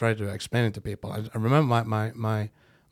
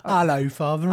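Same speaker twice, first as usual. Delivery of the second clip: higher, louder mock British greeting; cheeky British-accented hello, pitched up; put-on British accent; 6 semitones higher, 7 dB louder than usual. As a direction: play a boy with a fake British accent. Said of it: "goes with the British accent".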